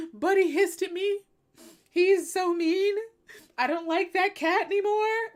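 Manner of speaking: high, loud fake-weepy whine; hammed-up tearful pout; mock crying. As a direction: pretend to bawl over a petty hurt. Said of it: "had I been crying over".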